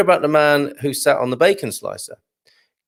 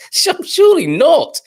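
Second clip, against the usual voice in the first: mock shocked; 6 dB louder than usual. Mock shock at listener comment.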